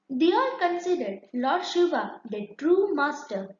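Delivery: ordinary delivery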